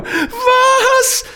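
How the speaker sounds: very high-pitched frightened yelp; startled cry. A narrator's reading. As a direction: cry out in alarm, pitch leaping. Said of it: "ruft Arelina verschrocken".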